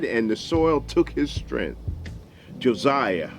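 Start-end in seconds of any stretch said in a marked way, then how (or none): none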